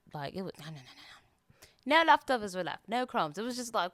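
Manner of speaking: casual podcast chat in a put-on British accent; everyday chatter in a British accent. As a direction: keep the British accent going the whole way. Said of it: "talk in a British accent".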